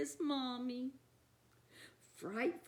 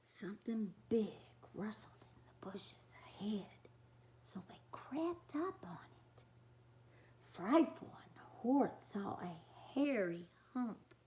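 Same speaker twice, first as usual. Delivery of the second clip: hushed whisper as they creep; suspense whisper; 5 semitones lower, 6 dB quieter than usual. A narrator's reading. Reader whispering the creeping-up scene to kids. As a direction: whisper it so nobody hears.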